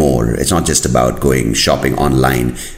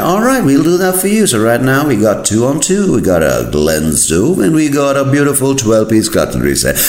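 high, hammed-up sales patter; mock shop host totting up the order; put-on high, obliging seller voice; eager salesman; 12 semitones higher than usual. Amazon as salesman confirming order.

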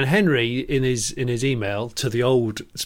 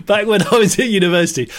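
laughing reread; 6 semitones higher, 4 dB louder, faster than usual. Shown as a demonstration.

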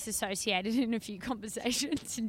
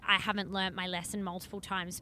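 ordinary delivery

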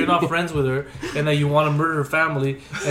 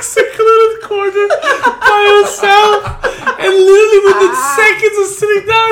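talking through high-pitched laughter; can barely get the words out for laughing; laughing hard; 14 semitones higher, 10 dB louder than usual. Real, not acted. Friend retelling the comedy club heckle, laughing hard.

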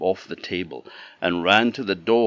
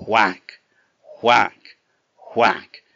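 loud whacks; 7 dB louder, much slower than usual. A narrator's reading. Axe chopping the beanstalk.

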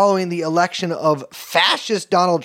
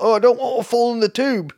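mock British accent; 6 semitones higher than usual. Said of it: "British phrase".

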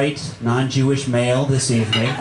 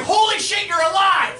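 high, thrilled dog voice; excited dog voice. Acted. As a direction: burst out like an overjoyed dog. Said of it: "it's like".

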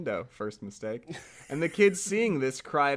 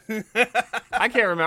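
laughing; 9 semitones higher, 6 dB louder than usual. Real, not acted. Laughs mid-line as miller.

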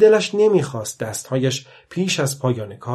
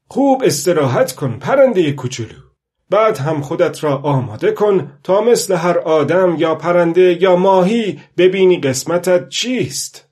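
put-on loud, higher old fisherman's address; old fisherman exhorting; 5 semitones higher, 7 dB louder than usual. A narrator's reading. Old man tells little bird to rest, loud exhortation. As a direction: call out advice to the little bird.

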